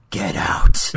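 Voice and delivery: sudden loud, aggressive bark; abrupt mock-angry shout. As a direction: explode at the listener in mock anger.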